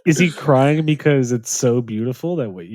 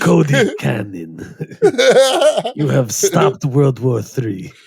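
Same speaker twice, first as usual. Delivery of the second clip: slow, solemn mock proclamation; slow pretend-leader declaration; Putin; slower than usual. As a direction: draw it out like a joking world leader. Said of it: "he's like".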